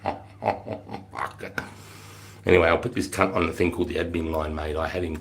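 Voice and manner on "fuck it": breathless low whisper; whispered aside. Retold or imitated. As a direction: mutter it under your breath. Reaction to shoulder barge.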